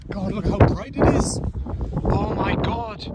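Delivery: high, breathless gasp of wonder; real awe. Real, not acted. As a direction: let the sky take your breath away. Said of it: "I'm shaking, I'm that excited".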